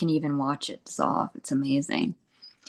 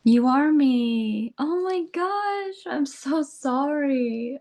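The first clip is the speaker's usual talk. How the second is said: much higher, slow and tearful; tearful crying; 10 semitones higher, slower than usual. Retold or imitated. Speaker apologising to her own self, crying high. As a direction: voice cracking high as if about to sob. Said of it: "like, crying".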